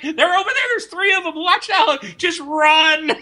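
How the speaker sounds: high, laughing, panicky warnings; frantic callouts through a laugh; freaking out. Retold or imitated. Gamer freaking out, warning teammate of enemies, laughing panic. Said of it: "freaking out".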